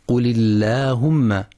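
"اللهم" with a light, clear L; thin lam. Shown as a demonstration. Teacher giving example after kasra, thin lam. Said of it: "ترقيق لام لفظ الجلالة إن سبقت بكسر".

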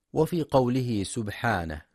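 usual speaking voice

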